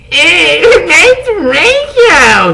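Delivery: high, slow tearful moan; moaning cry. Acted. Vampire moaning for Draco, crying. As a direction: wail it brokenly. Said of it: "he moaned".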